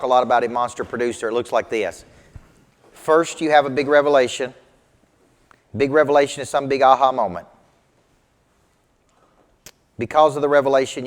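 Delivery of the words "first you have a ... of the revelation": louder, driving lecture lines; emphatic teaching. Real, not acted. Coach driving home his belief steps, louder. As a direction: hammer each step home. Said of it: "preaching the belief steps".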